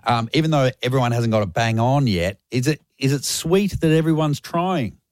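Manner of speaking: ordinary talk, nothing marked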